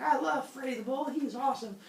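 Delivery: high-pitched adoring queen voice; mock girlish gushing. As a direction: gush about a beloved pet, way up high. Queen gushes about beloved bull.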